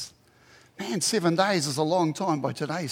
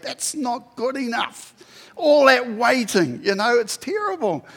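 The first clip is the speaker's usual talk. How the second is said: indignant complaint; 6 semitones higher, 5 dB louder than usual. Acted. Preacher mimics himself waiting for fast food, mock indignant. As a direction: complain with exaggerated outrage, leaning on each word. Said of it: "I think".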